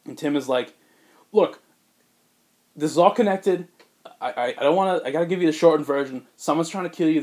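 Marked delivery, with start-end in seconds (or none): 1.3-7.2 high, loud and hurried; urgent warning. Retold or imitated. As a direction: rush it, he must listen now. Tim urgently warns Bruce.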